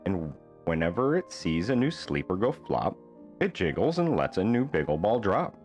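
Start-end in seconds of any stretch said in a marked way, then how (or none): none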